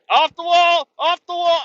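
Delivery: high, loud whistle-blowing yell; mock lifeguard shout. Teen lifeguards yelling at dad from the pool edge, mock shout. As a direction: bark it like a bossy teen lifeguard. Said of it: "They're like".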